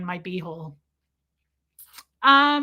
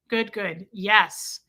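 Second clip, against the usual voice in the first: normal talking voice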